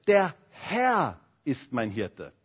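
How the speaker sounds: "Herr" stressed, slow and low; slow, lean on "Herr". Shown as a demonstration. Preacher showing the second stress on "Herr". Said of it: "Dann das zweite".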